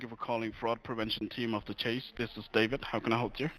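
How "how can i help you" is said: ordinary delivery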